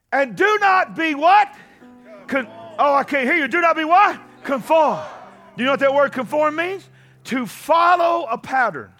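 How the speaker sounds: normal talking voice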